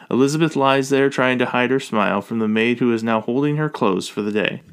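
ordinary delivery, nothing marked

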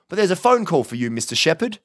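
high-pitched, polite village policeman; apologetic officer. A narrator's reading. Officer interrupts the visit, apologetic. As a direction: break in politely, pitched well up. Officer announces phone call.

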